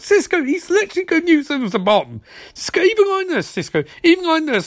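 high-pitched exasperated protest; exasperated pleading. Acted. Exasperated viewer pleading with character.

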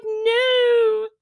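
high, loud wail of "No"; mock anguished cry. Maggie wailing at Barnabas, mock anguished cry. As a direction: cry it out like a doomed heroine.

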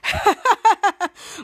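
loud, rapid acted cackle; put-on staccato laughing; mock villain laughter. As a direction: imitate bad guys laughing in triumph. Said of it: "While they were shouting and laughing".

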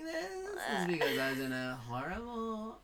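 creaky joking fry voice; vocal fry. Shown as a demonstration. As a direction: keep the fry going through the joke. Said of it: "That's like the voice I do".

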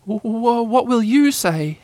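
high, slow halting question; fearful stammer. A narrator's reading. Shivering Piglet asking Pooh for his answer, fearful.

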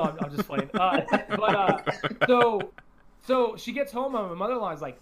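usual speaking voice

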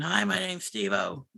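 a quiet, drawn-out sneering imitation.

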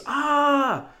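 high, drawn-out mock scream; imitated scream. Retelling the boy on the virtual roller coaster, mock scream. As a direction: let out a sustained high "ah" as a pretend scream. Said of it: "He puts his hands up and screams".

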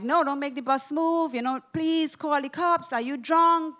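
high, loud alarmed calling out; alarmed pleading. Retold or imitated. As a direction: plead urgently with the driver.

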